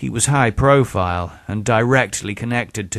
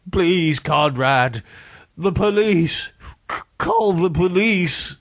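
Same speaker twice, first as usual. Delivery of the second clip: high, strained begging; desperate pleading; 8 semitones higher than usual. A narrator's reading. Beaten man begging Conrad for the police, desperate pleading. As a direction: beg desperately, higher in pitch. Kicked victim begs again.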